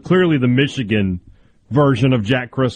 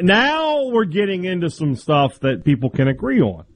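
usual speaking voice

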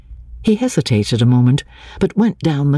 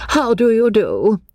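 high, pointed spinster's greeting; meaningful greeting; 10 semitones higher than usual. A narrator's reading. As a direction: greet in a high voice heavy with implication. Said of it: "with a meaning glance".

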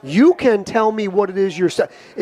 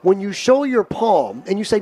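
normal talking voice

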